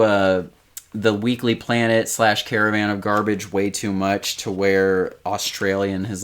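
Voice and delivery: usual speaking voice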